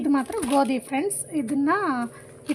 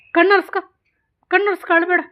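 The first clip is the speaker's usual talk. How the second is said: raised, insistent voice; firm parental command; 6 semitones higher, 9 dB louder, faster than usual. Real, not acted. Mother ordering crying child to stop.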